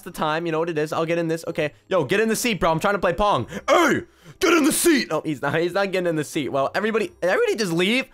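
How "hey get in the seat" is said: high, loud, demanding yell; insistent shout. Real, not acted. Host orders a player to join Pong, insistent shout. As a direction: holler it at someone ignoring you. Calls again to player ignoring him.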